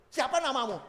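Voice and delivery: called out high and loud; heavenly messenger loudly asking a name; loud question. Retold or imitated. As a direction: demand the name boldly. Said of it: "Dengan lantang, dengan suara nyaring".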